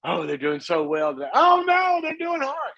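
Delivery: lightly voiced anxious parent, up then down; a hint of a fretful parent; parent on a rollercoaster. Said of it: "parents go up and down with them".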